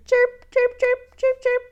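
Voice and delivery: high chirps; mama bird chirp. Shown as a demonstration. Teacher to children, chirping like a mama bird. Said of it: "A mama bird".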